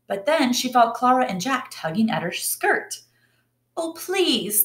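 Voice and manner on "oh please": high, begging little voices; children pleading. Acted. Clara and Jack beg the dance teacher, high and pleading. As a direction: beg sweetly, pitched up. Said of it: "they pleaded".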